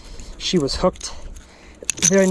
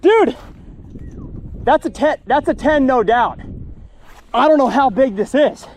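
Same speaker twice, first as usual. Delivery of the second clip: excited shout; 8 semitones higher, 4 dB louder than usual. Real, not acted. Huge fish just landed.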